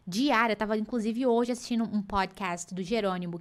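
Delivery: ordinary talk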